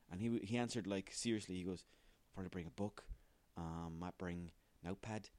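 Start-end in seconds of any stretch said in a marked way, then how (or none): 2.3-5.3 a hint of Gary Lineker, soft and quiet; loose Lineker impression. Host imitating Gary Lineker's serious desert island answer, softly. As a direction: lightly mimic him answering seriously. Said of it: "it doesn't talk like this, but it's something like this".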